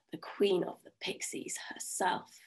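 hushed, awed whisper. A narrator's reading. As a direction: breathe it out in awe. Awed reveal of pixie queen.

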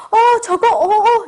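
high, loud amazed "어, 저거"; gasp of awe. Shown as a demonstration. Teacher acting out amazement for the class, loud and high. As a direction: gasp like you just saw something amazing. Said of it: "이렇게 하죠".

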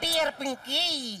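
slow, drawn-out salutation; teasing letter reading. Acted. Boy reads out a love letter to tease, slow recital. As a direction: stretch out the greeting as you read.

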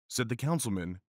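usual speaking voice

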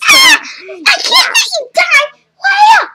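high alarmed calling out; alarmed cry. Acted.

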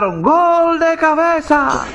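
slow, high stretched-out goal shout; crazy cheer. Acted. The madmen cheer a header goal, high drawn-out yell. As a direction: chant it like a stadium crowd. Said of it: "todos gritaron".

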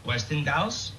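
Westinghouse as a question, with G for H, "Westin-gauss?"; relapsed mispronunciation. Acted. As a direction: join it up wrongly, Westinghouse as "Westin-gauss", rising. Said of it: "two rights made a wrong".

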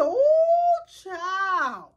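put-on high, loud squealing "Ooh, child"; excited exclamation. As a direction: shriek it out in delight. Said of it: "I said".

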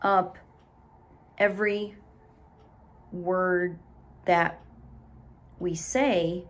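long pause after each word; broken word by word, no flow; choppy reading. Shown as a demonstration. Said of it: "we don't speak real choppy and break".

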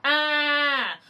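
"ah" held with no breath before it; closed-cord ah. Shown as a demonstration. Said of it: "vocal cords are very closed".

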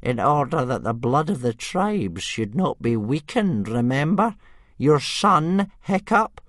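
high, loud, slow elder's voice; stern old man. Acted. Old Wrinkly reminds Stoic of his son, sternly. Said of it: "Old Wrinkly looked stern".

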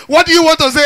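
normal talking voice

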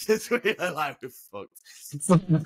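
high laugh breaking through the words, drawn out slowly. Real, not acted. Veteran laughing at his past danger. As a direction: giggle it out, half in disbelief.

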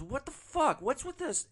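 high, indignant put-on voice; mad drunk passenger. Drunk passenger mad at the driver, high mock indignation. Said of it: "like he's mad at me".

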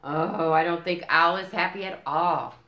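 ordinary delivery, nothing marked

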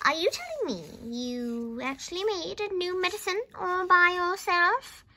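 high, incredulous grandma question; shouted. A narrator's reading. Grandma doubts George from the roof, shouting. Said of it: "she shouted".

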